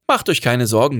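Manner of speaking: ordinary delivery, nothing marked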